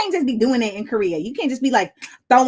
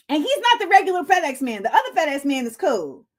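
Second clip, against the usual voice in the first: usual speaking voice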